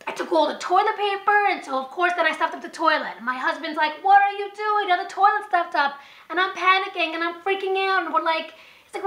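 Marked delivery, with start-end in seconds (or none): 4.0-6.0 alarmed husband. Retold or imitated. Husband's alarmed reaction quoted.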